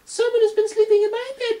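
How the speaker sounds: very high, loud squeaky cub; high squeaking baby bear; tiny Baby Bear squeak. Acted. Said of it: "squeaked in his tiny little voice".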